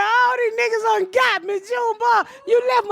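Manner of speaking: high, crying-out wail at Junebug; hammed-up despairing cry; mock wailing yell. Telling her son Junebug the thieves got her, wailing.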